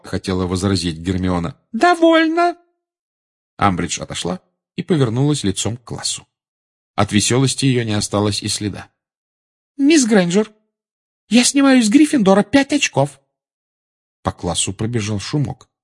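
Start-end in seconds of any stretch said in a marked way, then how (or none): 1.7-2.6 very high, loud command; sharp cut-off. A narrator's reading. Teacher cuts pupil off. 9.8-13.2 high, loud teacher's verdict; stern punishment. A narrator's reading.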